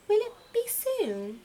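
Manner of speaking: high, hopeful little chick; eager baby owl asking mother. Acted. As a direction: ask it up high like a small child. Little Owl voiced by narrator.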